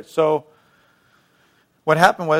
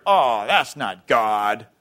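drawn-out, low scoffing dismissal; mock scoffing; 6 semitones lower, much slower than usual. Critics dismissing a ministry, mock scoffing. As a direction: dismiss it with a long, scornful "Oh". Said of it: "we scoff at 'em".

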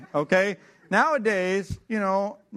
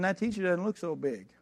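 usual speaking voice

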